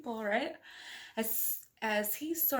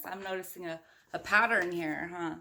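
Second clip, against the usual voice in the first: usual speaking voice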